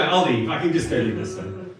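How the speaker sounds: ordinary delivery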